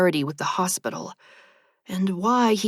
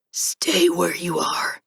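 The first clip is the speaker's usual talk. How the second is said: slow, strained, whispered monotone; ancient reaper whisper; slower than usual. A narrator's reading. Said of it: "strained and ancient their voices".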